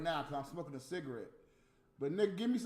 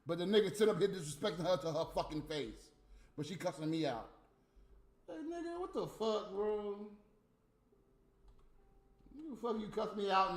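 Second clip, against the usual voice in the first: ordinary talk, nothing marked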